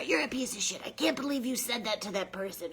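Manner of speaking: high, cruel taunting voice; demonic inner voice. Retold or imitated. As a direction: sneer like a nasty inner critic. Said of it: "how demonic my voice".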